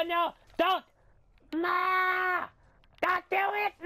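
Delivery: very high, loud shriek; hammed-up frantic screaming; panicked pleading. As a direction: squeal for mercy in mock terror. Screaming as he gets grabbed.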